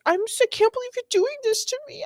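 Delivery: very high, hammed-up wail; fast, squeaky pretend sobbing complaint; mock drunk crying. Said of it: "she's crying".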